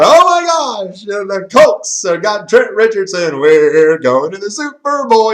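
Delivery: loud, high, drawn-out hysterical hype; mock freak-out. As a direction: imitate a fan losing it, stretching the words. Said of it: "everybody was freaking out".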